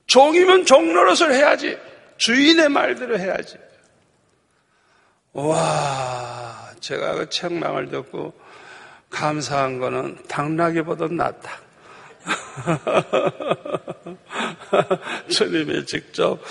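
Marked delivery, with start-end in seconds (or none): 0.1-3.6 loud, much higher retold scolding; stern rebuke. The Lord orders his servant to obey, scolding. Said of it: "책망을 하시는데". 12.3-14.1 soft real laughter; a quiet run of chuckles; amused chuckle. Real, not acted. Preacher amused at beating the donkey, chuckling.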